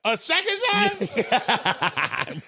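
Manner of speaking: slow high laughing question. Real, not acted. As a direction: laugh through the words. Laughing disbelief.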